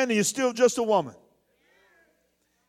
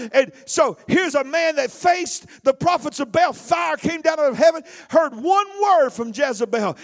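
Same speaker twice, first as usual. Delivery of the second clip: emphatic preaching; 4 semitones higher than usual. Acted. Preaching at full force.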